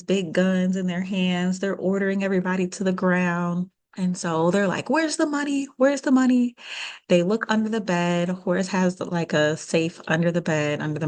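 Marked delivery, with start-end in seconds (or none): 4.9-6.6 half-acted shouting at the hostages; mock robber yell. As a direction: raise the pitch and bark it like a gunman demanding cash. Robbers yelling during break-in.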